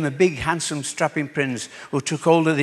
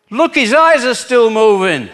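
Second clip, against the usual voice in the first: slow, loud and higher; loud punchline; 7 semitones higher, 6 dB louder than usual. Acted. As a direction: hit the last line hard.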